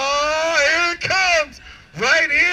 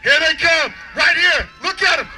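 announcer hype; 4 dB louder than usual. Real, not acted. Announcing finishers to crowd.

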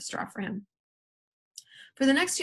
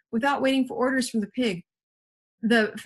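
usual speaking voice

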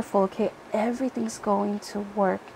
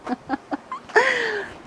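high laugh; 12 semitones higher, 3 dB quieter than usual. Real, not acted. Laughs at own joke.

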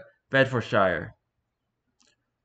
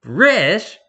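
loud, higher mock-British accent; exaggerated British accent; 8 semitones higher, 9 dB louder than usual. Shown as a demonstration. Satan echoing the interviewer's nationality, exaggerated British accent. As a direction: blurt it in a hammed-up British accent. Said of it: "in an exaggerated British accent".

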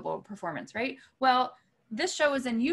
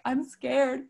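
put-on a bit higher, childlike fright; mock scared; 4 semitones higher, 3 dB louder than usual. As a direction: hide behind the words. Hiding from the artist.

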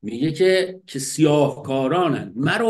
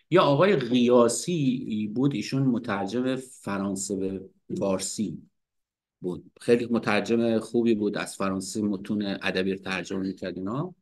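ordinary talk

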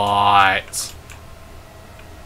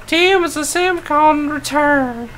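high reading voice; 7 dB louder than usual. Acted. Reads game text aloud.

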